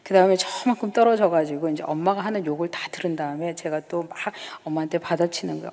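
ordinary talk, nothing marked

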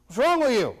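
dad's harsh question. Retold or imitated. Dad snaps at his guilty son at supper, mock stern. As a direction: bark it like an exasperated father. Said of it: "With all of the compassion that he has".

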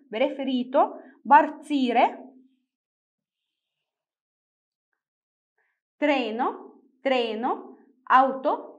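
Italian words read singly, each rising at the end; word-list demonstration. Shown as a demonstration. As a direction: dictate each word clearly to a class. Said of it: "نبدأ نقرأ الكلمات".

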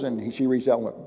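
usual speaking voice

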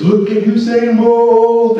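a hint of a stadium announcer, slow and high; announcer hype. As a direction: stretch it out like the PA voice. Stadium announcer hyping the crowd.

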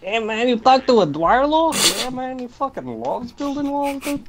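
high, loud mock Trump voice; hammed-up Trump bluster; Trump impression. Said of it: "I'll be the Trump".

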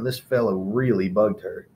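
ordinary delivery, nothing marked

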